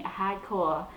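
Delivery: HARDCORE in a Boston accent, R dropped as "hahdcoah". Shown as a demonstration. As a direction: say HARDCORE like a Bostonian, without the R sounds. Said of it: "Boston accent".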